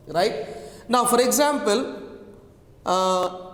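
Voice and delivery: usual speaking voice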